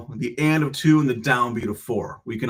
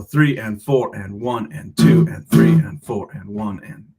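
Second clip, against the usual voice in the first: slow, louder counted beats; counting out loud; 3 semitones lower, 4 dB louder, slower than usual. Shown as a demonstration. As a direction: count carefully while playing. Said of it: "So let's do the two and three first".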